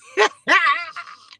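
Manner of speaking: high loud laugh; helpless loud high laugh; burst of laughter. Real, not acted. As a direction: laugh out before you can stop. Laughs at funeral story.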